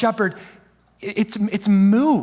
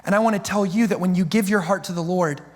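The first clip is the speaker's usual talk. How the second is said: ordinary talk, nothing marked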